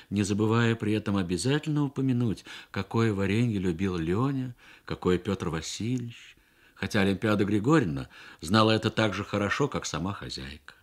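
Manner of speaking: plain reading voice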